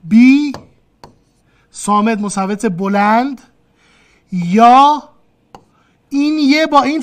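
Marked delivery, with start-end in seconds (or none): none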